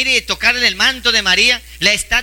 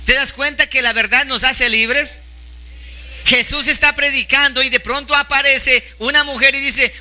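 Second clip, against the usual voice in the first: normal talking voice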